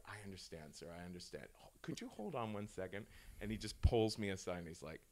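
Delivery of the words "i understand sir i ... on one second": low, soft, discreet voice; hushed, acted professional calm; placating manager. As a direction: soothe an upset customer very quietly. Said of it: "the manager is like".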